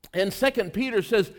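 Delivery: ordinary delivery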